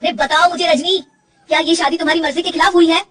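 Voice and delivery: husband pressing his wife to answer; pressing demand. Acted. (ज़ोर देकर तेज़ सवाल)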